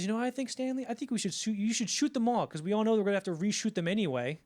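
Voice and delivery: high, loud, eager blurt; bursting out. Retold or imitated. Actor blurting his idea to the director, high eager outburst. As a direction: blurt it out all at once, pitched high. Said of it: "so he bursts out".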